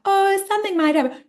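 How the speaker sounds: a limp, doubtful little wish; half-hearted doubt. Shown as a demonstration. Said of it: "I don't want you to, like".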